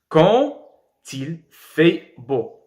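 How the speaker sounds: "quand il fait beau" spaced out, d as t before "il"; liaison with T. Shown as a demonstration. Teacher demonstrating liaison, "quand il" said as quan, til. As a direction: slowly link "quand" to "il" with a t. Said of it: "on l'a prononcé T".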